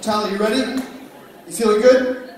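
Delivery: usual speaking voice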